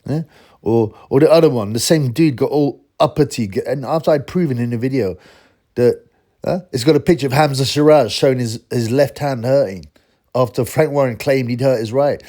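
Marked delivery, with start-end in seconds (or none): none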